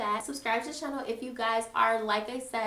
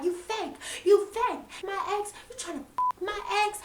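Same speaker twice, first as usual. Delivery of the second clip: higher, mocked angry accusation; accusing yell; 9 semitones higher than usual. Petunia accusing Maria during the fight, higher mock yell. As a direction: spit it out pitched up.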